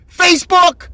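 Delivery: sharp, loud, rising jab at the viewer; demanding, loud rhetorical question; loud challenge. Real, not acted. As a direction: call them out, loud and high. Scolding parents glued to phones.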